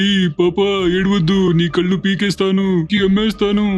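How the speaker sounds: high, mock-menacing thug voice; goon threat. Thug threatens the crying girl in a high put-on voice. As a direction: snarl threats at the child.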